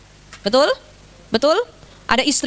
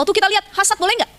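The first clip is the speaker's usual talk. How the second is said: normal talking voice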